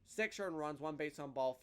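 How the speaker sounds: usual speaking voice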